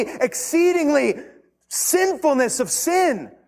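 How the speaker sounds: high, loud pulpit challenge; fiery sermon, stretching the last words; impassioned preaching. Acted. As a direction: hammer the question home. Preaching at full force.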